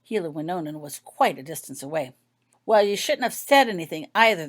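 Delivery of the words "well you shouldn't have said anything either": louder grumbling retort; loud complaint. A narrator's reading. Healer grumbling at the painting from afar, louder. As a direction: complain back across the room. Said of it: "the healer complained loudly".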